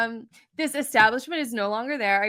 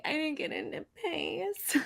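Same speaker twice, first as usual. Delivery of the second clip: mock crying whine; 6 semitones higher, 10 dB quieter than usual. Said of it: "Meanwhile, I'm, like, crying".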